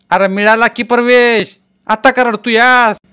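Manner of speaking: high, loud, slow grandmother's plea; loud calling out. A narrator's reading. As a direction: grandmother asks why boy is crying. Grandmother asks why he cries.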